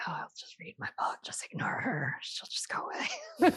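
soft whispered inner voice; whispered thought. Retold or imitated. Mother recalls ignoring her riled-up daughter, whispered to herself. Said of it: "I was just like".

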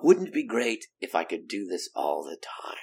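soft whispered wish to himself; whispered thought. Retold or imitated. [name] recalling his private thought after the show, hushed whisper. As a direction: breathe it out like a secret dream. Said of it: "And I thought".

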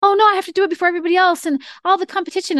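high, fast mock panic; panicky author. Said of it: "someone else was like".

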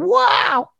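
very high, drawn-out mock wow; mock awestruck kids. Kids believing they met a star, in mock high amazed voice. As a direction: imitate amazed kids, hammed up. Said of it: "They went".

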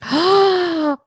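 high startled gasp; sharp gasp. Real, not acted. Hearing the colleague's lost deal, a high gasp. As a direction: gasp at the bad news.

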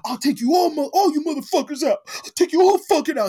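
put-on high, loud drunken threat; drunk rage. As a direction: yell it like a drunk ready to brawl. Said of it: "He's like".

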